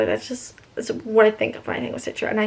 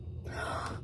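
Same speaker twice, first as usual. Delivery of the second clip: shocked gasp; 16 dB quieter than usual. Real, not acted. Shocked reacting to the book.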